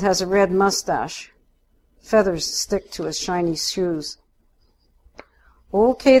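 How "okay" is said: high, loud cheery call-out. A narrator's reading. Cop calling to two quarreling women, cheery. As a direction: call out brightly to take charge. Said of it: "calls out in a cheery voice".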